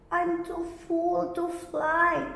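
high, wailing bee voice; a self-pitying wail. A narrator's reading. Greedy bee to the fireflies, high wail. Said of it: "wailed the greedy bee".

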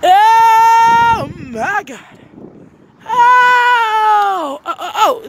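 excited shout. Real, not acted. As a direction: scream it out long, thrilled at the dog. Dog playing ball, huge reaction.

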